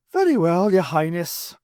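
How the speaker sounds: high, loud mock-reluctant Irishman. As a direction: give in with put-on reluctance. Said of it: "he said reluctantly".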